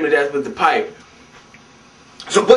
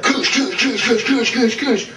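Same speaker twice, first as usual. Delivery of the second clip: rapid higher-pitched impact sounds; mock punching noises; 6 semitones higher, slower than usual. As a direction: mouth a volley of fight effects. Imitates blows in the fight.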